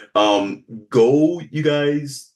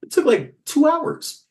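whiny complaint. Pretend.

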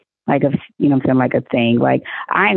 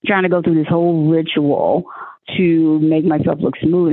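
ordinary delivery

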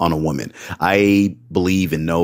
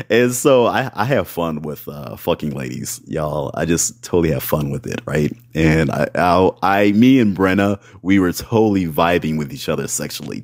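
ordinary talk

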